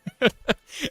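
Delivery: quick high laugh at himself; short laugh. Real, not acted. Laughing at his own rant, a quick high laugh. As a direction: laugh it off, amused at yourself.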